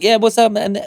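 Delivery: hyped voice note. Shown as a demonstration. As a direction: boom in like a cheerful mate. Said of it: "I'm a very much like".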